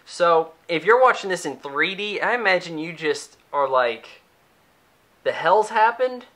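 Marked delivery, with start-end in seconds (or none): 5.2-6.3 higher, baffled question; mock confusion. Imagining a 3D viewer lost in the chaos, mock bafflement. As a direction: play a dazed moviegoer lost in the action.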